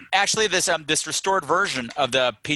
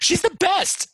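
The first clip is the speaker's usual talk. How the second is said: mock sweet voice. Mocking the fairy godmother.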